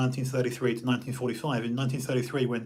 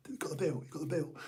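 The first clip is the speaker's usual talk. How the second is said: soft unvoiced whisper; half-mouth it, barely a sound; 11 dB quieter, much faster than usual. Signalling for the bill across the restaurant, whispered. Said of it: "you mouth it and you whisper it".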